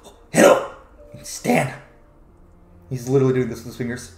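lightly voiced creepy little kobold; odd kobold shopkeeper. Kobold shopkeeper greeting customers, lightly odd voice. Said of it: "kobold man sitting on the thing goes".

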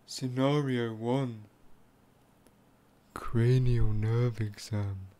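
slow, low unvoiced whisper; ASMR whisper. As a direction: breathe the title slowly.

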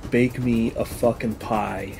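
ordinary talk

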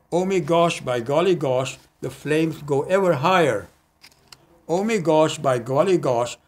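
bouncy refrain with "Oh Me Gosh" lifted each time; playful singsong recital; light-hearted poem. Acted.

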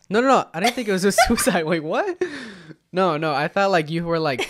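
high, loud laughing denial; laughing protest. Real, not acted. Laughing denial of suicide thought.

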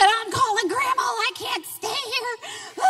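put-on high, slowed, pleading little-girl voice; imitating a distraught child. Retelling her daughter's breakdown, in a high tearful voice.

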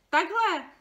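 high, loud shout; father shouts his big reveal; triumphant shout. A narrator's reading. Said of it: "vykřikl tatínek".

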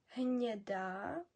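Гніда very slow with a soft palatal н; soft Czech н. Shown as a demonstration. Teacher models Czech Гніда slowly for learners. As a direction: draw out Гніда, softening the н. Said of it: "Н кажемо м'яке".

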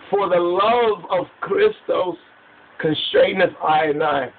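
scripture recitation. Acted. Preacher quoting scripture to his listeners, solemn recitation. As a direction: declaim the verse slowly, pitch lifted.

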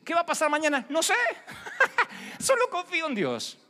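high, laughing believer who trusts God; voiced believer. Believer shrugging off tomorrow, high and laughing.

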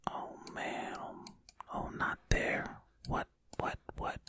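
hushed running commentary; ASMR whisper. Acted. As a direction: whisper close and intimate while solving. Whispered ASMR puzzle play.

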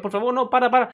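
high, pleading plea to stop. Retold or imitated. Dizzy lifeguard begging the father to stop, high plea. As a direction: beg him to stop.

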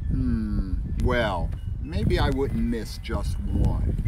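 slow, wavering hesitation; hesitant musing. Acted. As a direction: weigh it up slowly, unsure. Fish hesitates.

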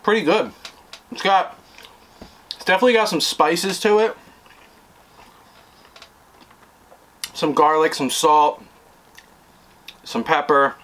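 speaking between bites; chewing while talking. Real, not acted. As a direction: mouth partly full of food. Seen 0: Bit burger at -9; jaw and cheeks keep chewing through the speech.

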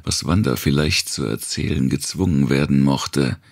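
usual speaking voice

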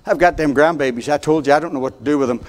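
ordinary talk, nothing marked

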